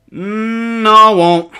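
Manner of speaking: high, loud drawn-out refusal; stubborn refusal. A narrator's reading. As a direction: strain it out while being sat on. Pinned boy refuses in sung quarrel.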